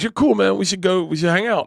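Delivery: high, breezy buddy voice; easygoing mock-enthusiastic invite; retold friendly invitation. Retelling Will's friendly invitation.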